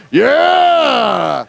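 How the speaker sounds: high, drawn-out shouted cheer; mock cheer. Comedian cheers bad news about home state, shouted. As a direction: holler it like a rowdy fan.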